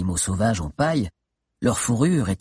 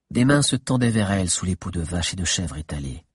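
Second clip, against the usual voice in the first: ordinary delivery, nothing marked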